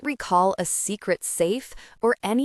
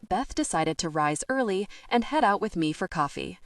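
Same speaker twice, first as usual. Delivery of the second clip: usual speaking voice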